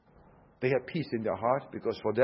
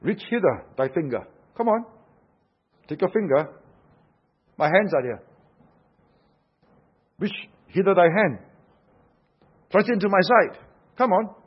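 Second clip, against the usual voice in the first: insistent, pressing invitation, growing louder and higher; urging challenge; 5 semitones higher, 6 dB louder than usual. Acted. Preacher voices Jesus challenging Thomas to touch him, urging. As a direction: press him to act, ending loud and high.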